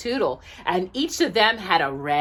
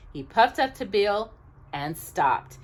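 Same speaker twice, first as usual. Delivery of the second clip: ordinary delivery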